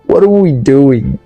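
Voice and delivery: ordinary delivery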